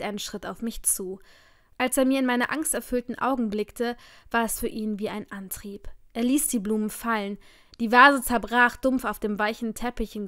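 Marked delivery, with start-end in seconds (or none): none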